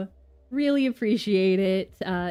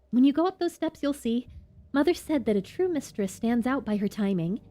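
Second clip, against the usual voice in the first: put-on girl's voice, wistful; 5 semitones higher than usual.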